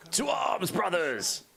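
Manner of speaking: very high strained war cry; rally the troops like a space marine; gruff barked parade orders. Retold or imitated. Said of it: "how they all sound when they're in combat".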